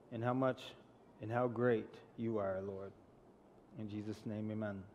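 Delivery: soft, hushed prayer that fades to near silence. Real, not acted. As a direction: pray quietly before the sermon. Opening prayer.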